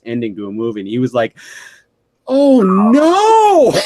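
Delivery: squeaky dismayed realization; high dismayed oh no. Retold or imitated. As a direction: pitch it way up in dismay. Coworker voiced realizing, retold.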